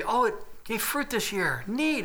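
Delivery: high-pitched pleased surprise; pretend delight. Owner finding fruit on a new fig tree, delighted.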